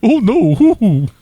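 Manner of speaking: high, loud cartoon dismay; internet voice. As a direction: wail in mock horror like an online whiner. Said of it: "do the whole episode like this".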